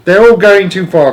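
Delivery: high, loud outcry; alarmed cry. A narrator's reading. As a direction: cry out a warning. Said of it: "cried Charlie".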